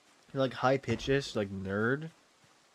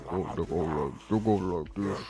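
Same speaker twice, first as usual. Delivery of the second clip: very low, put-on gamer voice; low, softer mock-deep mumbling; forced deep voice; 9 semitones lower, 6 dB quieter than usual. Shown as a demonstration. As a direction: push your voice deeper than it goes. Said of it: "tried to speak deeper than their voice would go".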